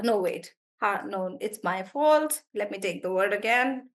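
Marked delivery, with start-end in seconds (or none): none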